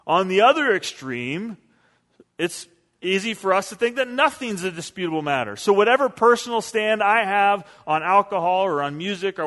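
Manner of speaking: normal talking voice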